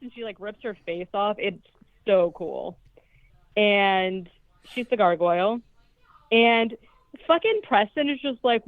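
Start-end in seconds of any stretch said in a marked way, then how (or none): none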